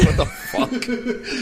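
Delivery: slow, drawn-out laughing disbelief; shocked disbelief. Retold or imitated. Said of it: "I was like".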